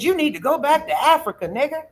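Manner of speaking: mock angry insult. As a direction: spit it out like the rude man did. Retold racist insult from man.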